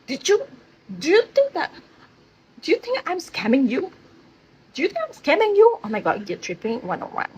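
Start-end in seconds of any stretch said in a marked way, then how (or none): 0.1-1.7 high, indignant mock of the boss; defensive outburst. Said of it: "he was become so defensive". 2.6-3.9 higher, offended "scamming" question; defensive boss, first take. Retold or imitated. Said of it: "he was become so defensive". 4.7-5.8 defensive boss, escalated. Retold or imitated. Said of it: "he was become so defensive".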